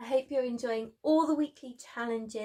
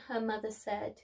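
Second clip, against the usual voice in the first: ordinary delivery, nothing marked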